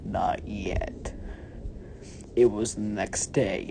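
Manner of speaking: ordinary delivery, nothing marked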